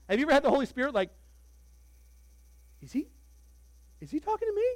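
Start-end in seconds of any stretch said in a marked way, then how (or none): 2.8-4.7 high, incredulous question; a squeaky "who, me?"; startled. Acted. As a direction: caught off guard, pointing at yourself. Listener realizes Spirit addresses them.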